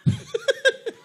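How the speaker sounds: sudden high laugh; high burst of laughter. Real, not acted. Preacher laughing at the idea of rebuking a child, high laugh.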